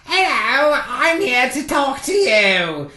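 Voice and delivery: high, loud little-guy impression; funny voice. Gatekeeper imitates the little guy for visitors, funny voice. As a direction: stretch it out in a silly high voice. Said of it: "he used a really funny voice".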